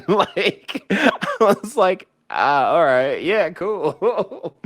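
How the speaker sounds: high-pitched, laughing through the words; real giggles pushing the voice way up. Real, not acted. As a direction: crack up mid-quote at your own gullibility. Cracks up recalling own naive reaction.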